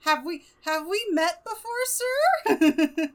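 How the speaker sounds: high, timid little mouse voices; high mock-timid mice. Mice nervously asking the badger if they've met. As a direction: squeak it up like a nervous young mouse. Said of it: "they're just like".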